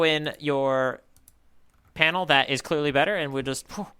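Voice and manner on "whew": normal talking voice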